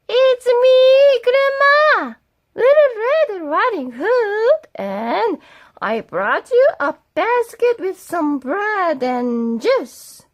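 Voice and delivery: put-on little girl voice. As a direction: pipe up like a small girl. Girl's voice at the door.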